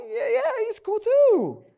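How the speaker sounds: mock wife. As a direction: shrug it off in her voice. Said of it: "she's like".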